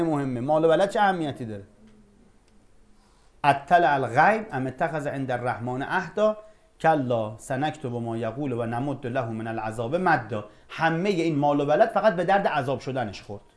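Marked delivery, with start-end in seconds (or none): 3.4-10.4 slow, measured recitation of the verse; chanted scripture, unhurried; Quran recitation. Acted. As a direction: intone the verse slowly and solemnly.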